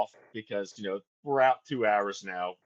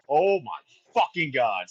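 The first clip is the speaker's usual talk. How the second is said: slow, louder mock dismay; stretched-out, raised-voice exasperation; retold panic; 4 semitones higher, 6 dB louder, slower than usual. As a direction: replay your dawning panic, drawing it out loudly. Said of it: "start going into, like, panic mode".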